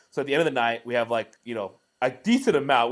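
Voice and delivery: ordinary talk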